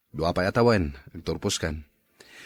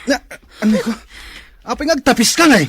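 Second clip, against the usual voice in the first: much higher, louder furious yell; angry shout; 14 semitones higher, 9 dB louder, faster than usual. Acted. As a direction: yell back at her in rage. Husband and wife quarrel. (ti lakay nga agpungtot iti asawana, agikkis)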